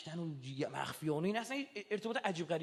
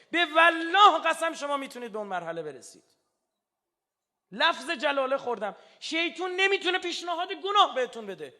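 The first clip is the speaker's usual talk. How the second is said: high, loud, impassioned preaching; fervent pulpit climax, almost shouting; preaching at full force; 8 semitones higher, 12 dB louder than usual. Acted. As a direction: thunder it out to the congregation. Fiery climax of sermon.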